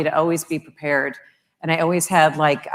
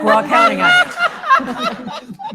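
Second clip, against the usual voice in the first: high, slow words squeezed through laughter; laughing; 9 dB louder, much slower than usual. Real, not acted. Mother of three teenagers teases Shelley, laughing hard. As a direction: laugh helplessly while teasing her. Teasing fellow parent of teens.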